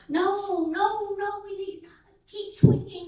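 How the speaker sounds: put-on high, loud child insisting; protesting toddler. Grandson protesting going inside, high and loud. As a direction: squeal no like a two-year-old.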